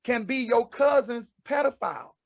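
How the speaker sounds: ordinary talk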